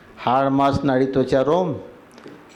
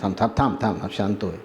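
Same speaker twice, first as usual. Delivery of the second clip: low, soft soothing murmur; hushed and lower, easing them down; calming someone down; 6 semitones lower, 4 dB quieter, much faster than usual. Acted. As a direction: quietly settle a flustered arrival. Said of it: "त्याला काय म्हणतो".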